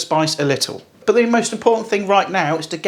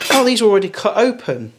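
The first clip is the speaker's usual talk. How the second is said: ordinary talk, nothing marked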